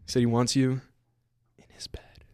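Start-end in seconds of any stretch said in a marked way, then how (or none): 1.6-2.2 unvoiced teasing whisper. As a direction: breathe it out like a secret. Teasing whisper about Jake.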